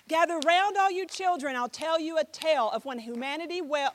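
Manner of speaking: projected rhythmic poem opening; storyteller's poetic call; spoken-word recital. Shown as a demonstration. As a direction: recite it out to the room with a beat. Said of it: "this is a spoken word here".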